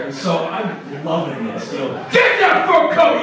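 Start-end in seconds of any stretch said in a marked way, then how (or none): 2.1-3.2 very high, loud shouted pitch; hawker yell. Retold or imitated. Fur coat hawker yelling at people on the street, full shout. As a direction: yell it at passersby. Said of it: "He's just yelling at people".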